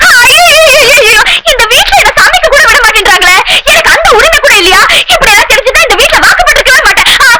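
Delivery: hammed-up high wailing lament; mock wailing. Daughter-in-law wailing at in-laws over being barred from cooking, hammed-up. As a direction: wail it out like a wronged bride. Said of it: "ஏன் இப்படி கத்துற?".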